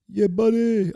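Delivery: high, loud drawn-out catchphrase; catchphrase impression. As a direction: call it out like a movie catchphrase. Quotes a movie character's catchphrase.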